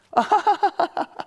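high, loud fake girlish giggle; forced high laugh. Pastor mimics women faking a laugh at a harasser, high and loud. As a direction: laugh it off to keep him calm.

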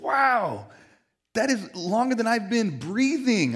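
high, loud wide-eyed wow; amazed. Retold or imitated. To the pastor, amazed at his marriage, high and loud. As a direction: marvel at the pastor's fifty-three years.

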